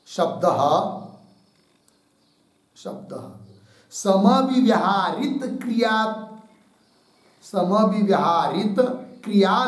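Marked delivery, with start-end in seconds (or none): 2.8-3.3 breathed out in a soft whisper; whispered repeat. Shown as a demonstration. Teacher repeating the dictated word to herself, whispering. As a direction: whisper the word back to yourself. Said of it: "teacher repeats word while dictating".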